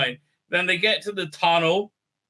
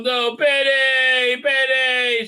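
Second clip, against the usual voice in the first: mock wail; 7 semitones higher, 4 dB louder, much slower than usual. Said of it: "she says".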